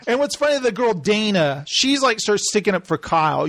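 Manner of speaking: usual speaking voice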